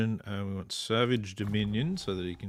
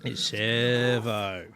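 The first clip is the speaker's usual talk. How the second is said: drawn-out, nasal Australian "servo"; broad Australian "servo", slow and louder; 2 semitones higher, 6 dB louder, much slower than usual. Shown as a demonstration. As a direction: stretch "servo" slowly through the nose, Aussie style. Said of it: "you gotta really say it nasally".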